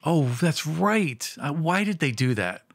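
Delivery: ordinary delivery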